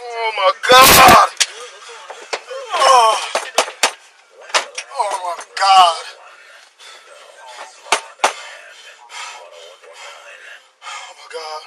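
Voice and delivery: low, slow, stunned voice; in shock, barely getting it out; shaken. Real, not acted. Officer in shock after shooting.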